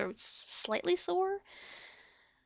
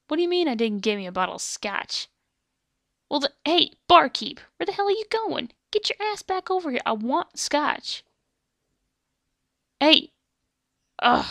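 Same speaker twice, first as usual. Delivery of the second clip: loud, high demand at the barkeep; angry gunslinger shout; 4 semitones higher, 8 dB louder than usual. Acted. Gunslinger calling the barkeep back for scotch, irate yelling. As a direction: holler after someone walking off.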